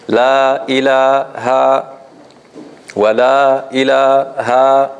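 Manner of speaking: La ilaha, wa la ilaha drawn out slowly with equal mad; even recitation practice. Shown as a demonstration. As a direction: recite slowly, keeping every long vowel equal. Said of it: "You say it like this".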